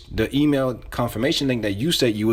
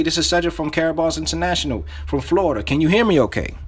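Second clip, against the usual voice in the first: upbeat, higher-pitched sales greeting; enthusiastic; 5 semitones higher than usual. Shown as a demonstration. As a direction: open the cold call with real energy. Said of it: "you gotta say this with enthusiasm".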